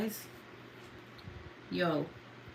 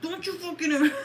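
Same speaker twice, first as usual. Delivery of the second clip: mock stern scolding; 9 semitones higher, faster than usual. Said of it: "I'm like".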